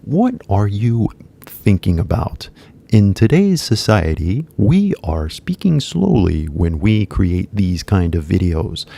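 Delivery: slow, careful educational-video delivery; slow video-teacher voice. Shown as a demonstration. Teacher mocks the slow speech of educational videos. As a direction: talk deliberately slowly like a lesson video. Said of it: "こういう喋り方しますね".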